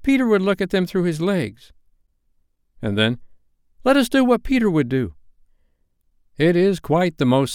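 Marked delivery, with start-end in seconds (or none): 0.0-1.7 put-on high, eager boys' cry; boys crying out. A narrator's reading. Lost Boys recalling Peter's trick, crying out high. 3.9-5.1 high-pitched rallying cry; eager boys' cry. A narrator's reading. Lost Boys urging each other on, high eager cry.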